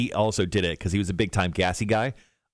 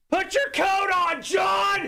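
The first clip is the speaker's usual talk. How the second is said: very high, drawn-out holler; retold dad's scream; much slower than usual. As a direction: yell it like a dad from a passing car. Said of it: "and screamed".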